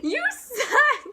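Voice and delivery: loud high laughing jab; playful accusation, laughing; laughing mock insult. Real, not acted. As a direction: tease her through a laugh. Teasing friend on the phone.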